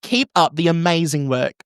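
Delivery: broad Australian letter-reading voice; Australian accent. Acted. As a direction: ham up the letter in an Aussie accent. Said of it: "read this in an Australian accent".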